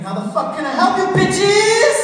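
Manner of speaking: sudden high yelled insult; profane shout. Retold or imitated. Business manager yelling at a caller. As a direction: blurt it out loud into the phone. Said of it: "and I said".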